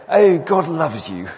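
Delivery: loud, slow posh churchman; hammed-up posh English, drawn out; posh accent. Preacher mocks middle-class Church of England love, posh accent. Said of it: "I'll try and do a posh accent".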